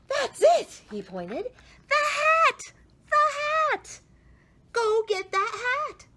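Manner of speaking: put-on high, loud, thrilled kid voice; a boy who just found the fix; squealing with a bright idea; excited shout. A narrator's reading. Oliver excited, found the answer.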